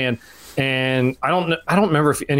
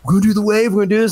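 mock pushy hype; 5 semitones higher, 4 dB louder, much faster than usual. Guy pushing old couple.

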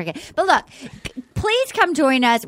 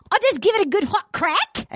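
higher, bad Australian accent attempt; 8 semitones higher than usual. Shown as a demonstration. [name] tries out an Australian accent for listeners, a bad Australian accent. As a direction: try an Aussie accent that slides toward Irish. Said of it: "I'm gonna try as Maddie".

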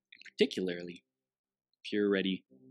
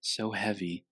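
guided meditation. Acted.

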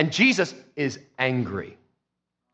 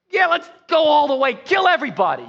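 high, louder eager cheering; excited zealots; 9 semitones higher, 4 dB louder than usual. Retold or imitated. Zealots cheering Jesus cleansing the temple, excited loud cry. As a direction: egg on the fight with gleeful zeal. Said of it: "they're, like, excited".

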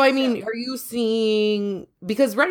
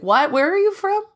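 higher-pitched incredulous question; retold surprise; 10 semitones higher than usual. Co-host retells hearing an Illinois accent, surprised question. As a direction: jump up in pitch, taken aback. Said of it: "I was like".

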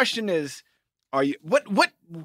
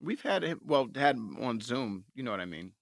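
stoned; 10 semitones lower, 12 dB quieter than usual. Real, not acted. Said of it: "you're so stoned right now".

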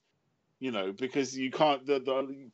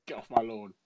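put-on grumpy old man; 3 dB quieter than usual. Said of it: "Victor Meldrew stage of life".

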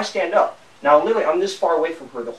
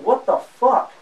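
higher, baffled mock exclamation; retold disbelief; 5 semitones higher, slower than usual. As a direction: replay your incredulous reaction, not shouted. Retelling his reaction to her screaming.

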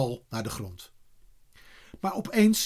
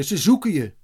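raised, louder call; 4 semitones higher, 5 dB louder than usual. A narrator's reading. Boys tell Eddy he is wanted, calling out. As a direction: call the news up to him. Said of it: "klonk het weer".

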